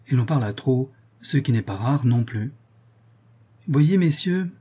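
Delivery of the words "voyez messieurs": mild, high-pitched call to the company; exclaiming. A narrator's reading. Young hothead addressing his friends at supper, exclaiming. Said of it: "s'écria un jeune fou".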